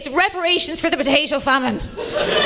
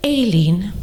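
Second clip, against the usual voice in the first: low mock-posh English voice; posh boyfriend; 7 semitones lower than usual. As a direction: imitate an awfully posh older Englishman. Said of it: "he's awfully posh".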